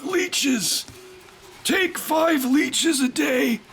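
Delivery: high, slow shouted prescription; a hammed-up quack doctor; doctor prescribing leeches to the patient.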